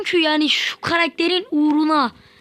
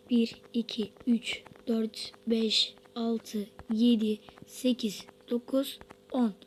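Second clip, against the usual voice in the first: counting softly under the breath; hushed counting ritual; whispered count; 3 semitones lower, 8 dB quieter, slower than usual. Real, not acted. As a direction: whisper the numbers one by one. Counting ten taps, hushed.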